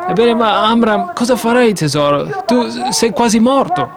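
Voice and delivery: lightly voiced anxious Jochebed; worried wife. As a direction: fret over a husband nearly dead.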